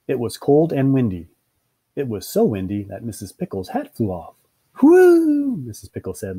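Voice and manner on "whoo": loud, very high whoop; thrilled high cry; gleeful whoop. Acted. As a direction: whoop with delight, high and long.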